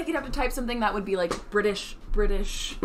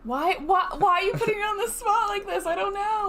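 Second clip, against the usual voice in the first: very high, squealed protest; flustered protest; 12 semitones higher than usual. Real, not acted. Co-host protesting being put on the spot, high flustered squeal.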